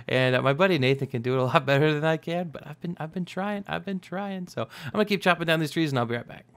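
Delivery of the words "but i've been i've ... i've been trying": high, soft, quick character voice; Korg impression. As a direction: lightly imitate a movie character, pitched up. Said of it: "I've been trying to do the voice".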